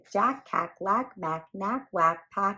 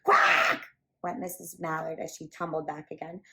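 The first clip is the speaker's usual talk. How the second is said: ordinary delivery, nothing marked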